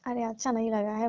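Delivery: normal talking voice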